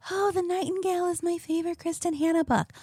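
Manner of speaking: mocking gushing fan. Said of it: "so many people are like".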